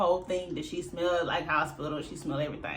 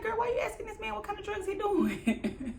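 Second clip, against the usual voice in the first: laughing disbelief; 13 semitones higher, 4 dB quieter than usual. Retold or imitated. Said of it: "I'm like".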